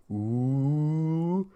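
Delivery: long, loud, rising taunting ooh; mocking class "ooh". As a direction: imitate kids teasing someone in trouble, sliding up in pitch. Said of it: "All the kids in my class are going".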